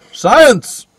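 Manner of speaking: high, loud burst on one word; mock triumphant shout. Cutting in with the movie's sensible explanation, mock triumphant shout. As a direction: shout it out like a grand answer.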